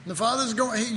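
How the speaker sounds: ordinary delivery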